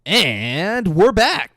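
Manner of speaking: loud, drawn-out announcer's return; hammed-up host intro, high and slow; show-host comeback. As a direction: stretch it out big, welcoming listeners back.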